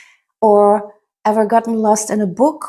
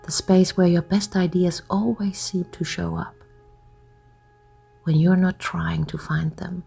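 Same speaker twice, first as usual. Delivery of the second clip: soft, lower voice with long pauses between phrases; guided hypnosis; 3 semitones lower, 7 dB quieter than usual. Acted. Hypnotist guiding listeners to let their thoughts drift, soft hypnotic voice. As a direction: lull the listener gently, hushed and unhurried.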